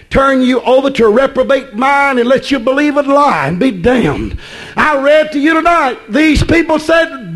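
full-force preaching. Real, not acted. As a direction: preach it with rising urgency.

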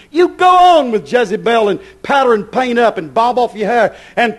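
loud, high hellfire sermon; fiery preaching. Acted. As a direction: thunder it from the pulpit. Preacher at full force.

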